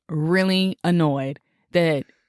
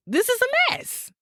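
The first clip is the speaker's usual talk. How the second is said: exasperated. Real, not acted. Exasperated about plume.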